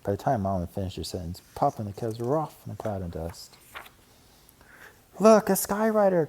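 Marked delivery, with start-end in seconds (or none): 5.2-6.3 high, loud excited cry; cried out. A narrator's reading. Sister cub crying out at a toy sign, high and loud. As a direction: point and shout. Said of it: "cried Sister".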